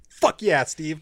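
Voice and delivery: loud, high burst of triumph; excited cheer. Retold or imitated. Fan reliving the baseball bat moment, cheering.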